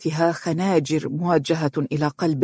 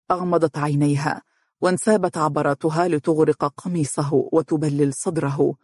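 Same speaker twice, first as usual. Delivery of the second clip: ordinary delivery, nothing marked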